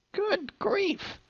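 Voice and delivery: very high, strained exasperation; squeaky good grief. Real, not acted. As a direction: squeak it out in disbelief. Exasperated at thirteen pages.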